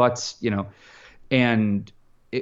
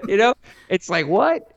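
high laugh breaking into an incredulous what; real laughter, higher and amazed; can't believe it, laughing it out; laughing disbelief; 14 semitones higher than usual. Real, not acted. Laughing at the Fallon credit.

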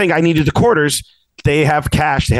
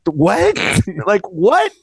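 high, baffled questioning; retold disbelief; 7 semitones higher, much slower than usual. Customer reacts to lady refusing coins, incredulous. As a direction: act out a stunned reaction. Retelling own stunned reaction.

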